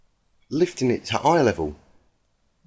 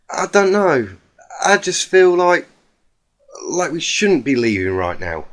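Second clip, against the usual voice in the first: Applejack voice, upset; 7 semitones higher, 4 dB louder than usual. Acted. Applejack pleads to stay.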